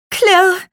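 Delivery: loud, high imitation of shattering glass; high, loud crash word. A narrator's reading. Window pane cracks under the spell, loud high crash word. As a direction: cry out the crash sharply. Narrator voices the breaking glass.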